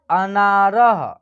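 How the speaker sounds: अनारः stretched very slowly, ending in a breathy ह; very slow clear reading. Shown as a demonstration. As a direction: draw out अनारः for learners. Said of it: "उसके बाद लिखा है". (अनारः को बहुत धीरे पढ़ें)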